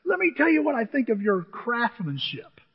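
imitated boss's scornful tirade; retold angry foreman. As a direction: play the foreman tearing into a worker. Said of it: "he starts cussing me out".

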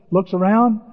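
ordinary talk, nothing marked

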